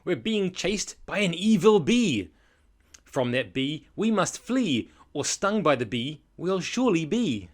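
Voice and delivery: hammed-up storybook alarm; urgent warning. Terry warns the tea lady about the bee, urgent alarm. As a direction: build loudly on the villain's name.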